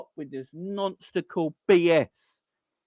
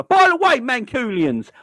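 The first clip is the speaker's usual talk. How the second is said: loud callout; 6 semitones higher, 6 dB louder than usual. Real, not acted. Loud turn to Mancunians.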